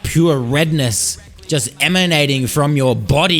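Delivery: normal talking voice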